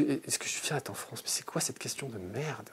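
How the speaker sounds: unvoiced whispered aside; whispering. Real, not acted. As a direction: whisper it under your breath.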